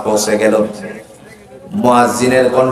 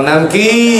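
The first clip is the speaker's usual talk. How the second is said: high, raised call to the crowd; louder prompting; 9 semitones higher, much slower than usual. Real, not acted. Preacher asking crowd to say the name louder, raised call. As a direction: ring out "নাম কি" to draw a louder answer. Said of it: "আর একটু জোরে".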